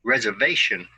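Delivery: "Reservation" with the stress on VA: res-er-VA-tion; "Reservation" as a teacher models it for repeating, VA stressed; model word stress. Shown as a demonstration. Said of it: "listen and repeat after me".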